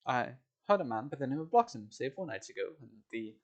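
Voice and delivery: a hint of a London Cockney carter, friendly; Cockney accent. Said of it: "and we're sorry for the accent".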